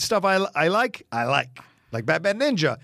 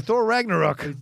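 attempted New Zealand accent, higher; 6 semitones higher than usual. Shown as a demonstration. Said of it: "I'm trying to do the New Zealand accent".

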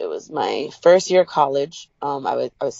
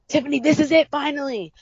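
excited realization; 9 semitones higher than usual. Retold or imitated. Inner voice realizing her purpose.

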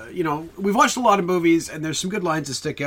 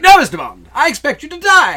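loud, high, off-the-cuff villain reply; flippant Goldfinger; 10 semitones higher, 9 dB louder than usual. Shown as a demonstration. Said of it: "kind of off the cuff and flippant".

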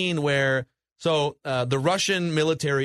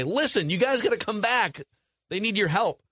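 urgent appeal; 7 semitones higher than usual. Acted. Voicing freed prisoners pleading with villagers.

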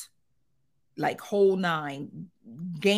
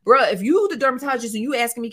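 ordinary delivery

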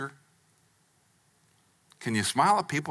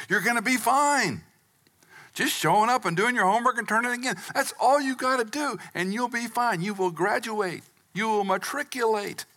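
exasperated parent; 8 semitones higher than usual. Retold or imitated. Father lecturing his kids about school, high exasperated nagging. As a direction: nag the kid like a fed-up dad. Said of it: "telling my children".

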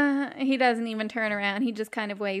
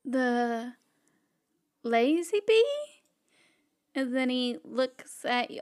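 ordinary delivery

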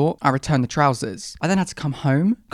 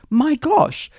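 exasperated exclamation; 12 semitones higher, 5 dB louder than usual. Real, not acted. Exasperated at confusing store.

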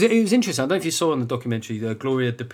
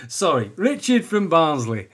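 mock Northern accent; 3 semitones higher, 3 dB louder, slower than usual. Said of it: "Sorry for the accent confusion".

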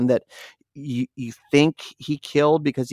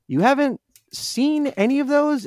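high-pitched disbelieving question; incredulous; 13 semitones higher than usual. Retold or imitated. Player asks friend about the nutcrackers, incredulous. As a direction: re-enact asking in disbelief, pitch shooting up.

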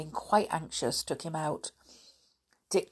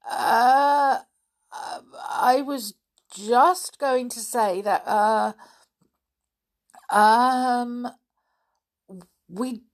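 drawn-out, higher-pitched hesitation; flustered stalling; 6 semitones higher, 4 dB louder, much slower than usual. A narrator's reading. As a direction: stall for words with long ums. Caught out, stalling for an answer.

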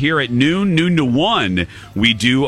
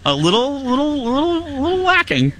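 rising squeak; 13 semitones higher than usual. Pretend. Teasing complaint, voice climbing higher.

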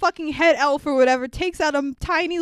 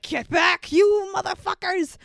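higher-pitched acted yell; mock angry shout; 6 semitones higher than usual. Teddy rescuing the bag from bad guys, mock shout. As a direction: play a hammed-up warning shout.

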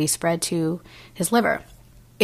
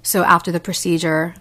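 ordinary talk, nothing marked

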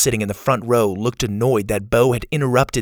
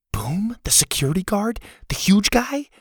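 high-pitched, hushed amazement; astonished, pitch rising step by step; incredulous questions; 10 semitones higher than usual. Acted. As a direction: check in disbelief, climbing with each question. Listener startled by the name.

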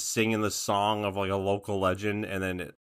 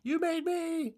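high sing-song title; 4 dB quieter, much slower than usual. Acted.

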